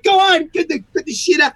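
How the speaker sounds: high loud shouted dare; mock dare. Daring the attacker to keep punching, shouted high and loud.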